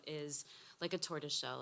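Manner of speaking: usual speaking voice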